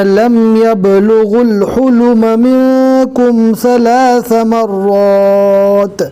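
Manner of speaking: slow melodic tajweed chant; Quran recitation. Shown as a demonstration. Teacher reciting verses to the class, melodic and measured. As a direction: recite it, drawing out each verse.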